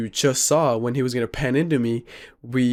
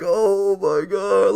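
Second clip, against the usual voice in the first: higher, very drawn-out exclamation; retold tearful relief; 5 semitones higher, much slower than usual. Reacting as the friend wakes, retold tearful relief. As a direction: stretch it out, pitched up, near tears. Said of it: "I was about to tear up".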